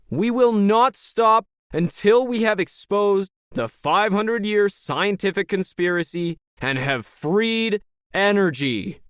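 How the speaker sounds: high-pitched, slow, ringing proclamation; rousing rally leader. Acted. Leader vows to expose the conspiracy, fervent rally oratory. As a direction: vow it to the crowd, stretching each phrase.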